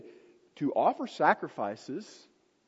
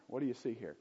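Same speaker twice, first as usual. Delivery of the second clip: ordinary talk, nothing marked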